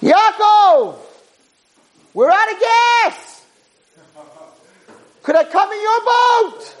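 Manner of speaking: very high, strained yell across water; screaming. Acted. Stranded brother on the yacht calling Yaakov, screaming. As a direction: scream out for help from afar. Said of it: "And he's screaming".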